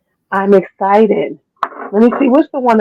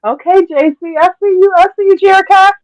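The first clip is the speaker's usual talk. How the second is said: excited; 11 semitones higher than usual. Retold or imitated. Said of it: "I was like".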